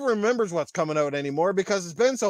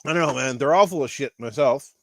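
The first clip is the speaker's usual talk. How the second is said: usual speaking voice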